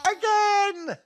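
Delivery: put-on high, loud gleeful baby squeal; baby shouting. Baby cheering the fall off the stool, high and loud. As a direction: squeal it like a thrilled toddler. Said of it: "The baby laughs and shouts".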